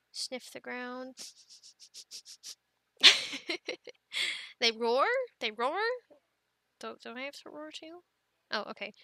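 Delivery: normal talking voice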